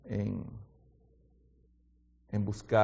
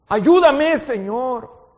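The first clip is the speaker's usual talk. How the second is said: loud, high pleading prayer; earnest prayer cry; fervent prayer; 16 dB louder, much slower than usual. Acted. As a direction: cry out to God for help.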